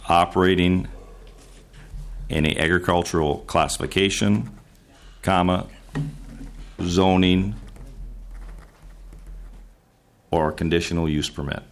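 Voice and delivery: word groups spaced out with long pauses; slow dictation. Real, not acted. Senator repeats amendment wording for the chair, slow dictation. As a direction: dictate slowly, waiting between phrases for the note-taker. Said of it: "Repeat it slowly".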